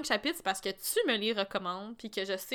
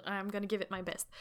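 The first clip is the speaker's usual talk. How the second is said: usual speaking voice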